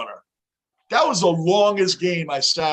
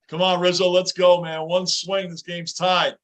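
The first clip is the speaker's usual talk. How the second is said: usual speaking voice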